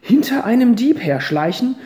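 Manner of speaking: put-on high, loud, firm old lady addressing the boys; grandmother's speech. Grandmother stands to lecture the boys, high and loud. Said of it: "fuhr die Großmutter fort".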